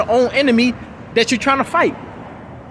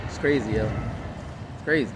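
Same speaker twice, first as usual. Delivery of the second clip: low, much softer mutter; muttered aside; 11 semitones lower, 11 dB quieter than usual. Real, not acted. Muttering to himself after the rant, low and soft. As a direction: shake your head and mutter it.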